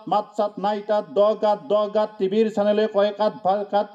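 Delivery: normal talking voice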